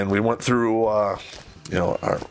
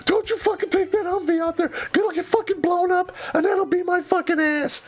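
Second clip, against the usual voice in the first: much higher, hammed-up yelling boss; mock angry commander. Commander forbidding the Humvee, mock furious and much higher. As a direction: play the ranting commander over the top. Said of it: "he looks at me and goes".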